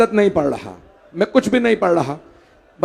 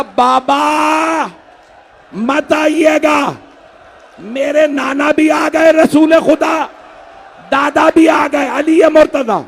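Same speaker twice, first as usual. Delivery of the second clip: high, very slow, drawn-out cry; dying son calling to his father, wailed high and slow; wailed lament; 7 semitones higher, much slower than usual. Acted. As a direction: stretch every word into a mourning wail. Dying son's words wailed.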